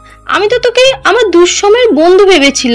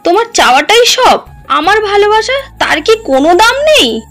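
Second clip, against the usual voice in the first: high-pitched wounded protest; hurt, indignant challenge; 10 semitones higher than usual. Acted. Wronged wife challenges husband's excuse, high indignant protest. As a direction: throw it back at him, pitch soaring.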